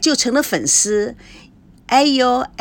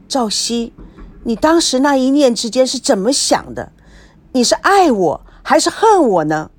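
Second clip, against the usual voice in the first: ordinary delivery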